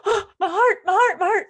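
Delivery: high, loud swooning cry; gushing, overcome. Retold or imitated. Reader melts at boys cuddling, gushing swoon. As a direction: gasp and swoon, hammed-up. Gushes over the tender scene.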